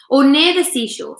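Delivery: a touch of eager hope; eagerly. A narrator's reading. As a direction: jump in, keen to see the sea. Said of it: "said James eagerly".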